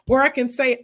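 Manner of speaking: usual speaking voice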